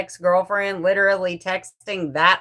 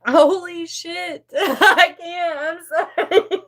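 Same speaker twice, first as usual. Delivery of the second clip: overwhelmed laughing; 7 semitones higher than usual. Real, not acted. Vlogger stunned by new supporters, laughing. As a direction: laugh through the words, stunned. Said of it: "she's laughing and crying".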